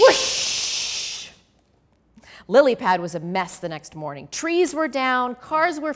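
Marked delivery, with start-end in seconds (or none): 0.0-1.3 high whispered whoosh; wind whoosh. A narrator's reading. Reader answering with wind, high whispered whoosh. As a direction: blow like the storm.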